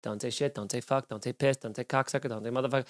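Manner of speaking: hushed self-reminder not to curse; quiet inner nagging; nervous inner voice. Retold or imitated. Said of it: "I'm just being like".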